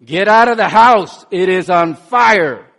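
loud urgent shout; fireman yelling. Retold or imitated. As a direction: yell it like a fireman at a burning house. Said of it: "he yells at the person".

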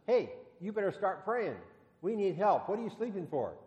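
urgent call. Retold or imitated. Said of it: "and he says".

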